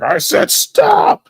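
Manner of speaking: very high, slow, stretched yell on stop; drawn-out shouted command. Retold or imitated. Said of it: "He's like".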